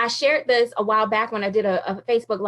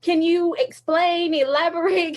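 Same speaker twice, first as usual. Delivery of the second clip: freaking out; 6 semitones higher than usual. Retold or imitated. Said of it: "completely freaking out and stressing out".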